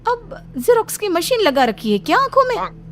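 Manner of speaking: very high-pitched, aghast wail; shocked elder sister-in-law. Acted. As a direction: squeal it out in comic disbelief.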